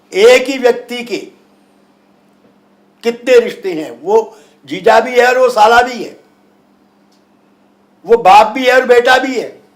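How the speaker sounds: loud, high, emphatic sermon; hindi teleshopping host. Acted. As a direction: preach it to the crowd. Guru preaching with rising force.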